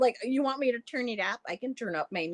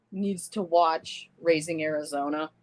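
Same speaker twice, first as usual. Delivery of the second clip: normal talking voice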